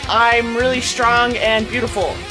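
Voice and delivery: ordinary talk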